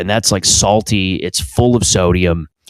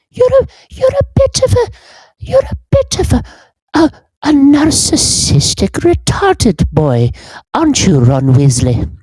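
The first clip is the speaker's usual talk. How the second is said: put-on prim old schoolmistress scolding a pupil, pitched way up; McGonagall voice. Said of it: "I put on her voice".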